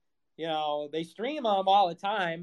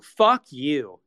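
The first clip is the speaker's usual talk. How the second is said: louder fuck you; 3 semitones lower, 7 dB louder than usual. Real, not acted. Streamer telling off fans, louder second insult. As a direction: punch it out louder.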